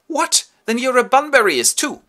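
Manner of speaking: high, loud delighted shock; gleeful surprise. Acted. Algy finding out Jack is a Bunburyist too, gleeful. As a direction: pounce on the discovery.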